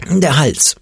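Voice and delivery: a lightly croaked sore-throat voice; croaking. A narrator's reading. Ole fakes a sore throat to Opa with a mild croak. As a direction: fake a croak, understated. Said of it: "krächzte er".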